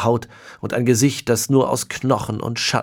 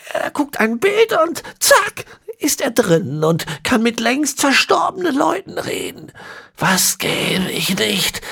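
ancient villain. A narrator's reading. Ezekiel explaining the horse bones, high and whispery. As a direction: rasp out the words like a centenarian.

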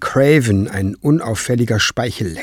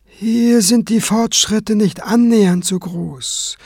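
higher, slow, measured concession; reluctant admission; 10 semitones higher than usual. A narrator's reading. Kranz admitting his pupil's weak progress, reluctant. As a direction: concede it carefully. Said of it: "musste Kranz zugeben".

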